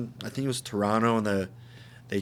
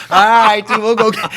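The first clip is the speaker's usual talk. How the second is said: much higher and louder, hyped to go drink; rally the group like you're ready to go; pumped-up buddy; 11 semitones higher, 13 dB louder than usual. Retold or imitated. Friend pumped to outdrink Canadians.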